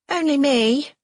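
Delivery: high, loud call from the door. A narrator's reading. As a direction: call out cheerily from the hall. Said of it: "a voice calls".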